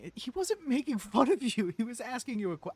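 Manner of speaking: high, loud protesting; loud, pleading protest; heated defence. Real, not acted. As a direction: argue back hard.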